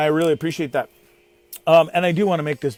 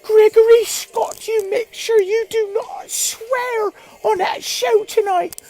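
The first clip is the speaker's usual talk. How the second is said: much higher, slow mock mom voice; imitating mom; slower than usual. Mom warning him not to swear, high mock scolding. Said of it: "And she's like".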